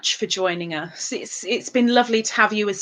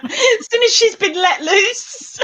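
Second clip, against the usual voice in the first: teasing; 9 semitones higher, 4 dB louder, slower than usual. Real, not acted. Teasing correction.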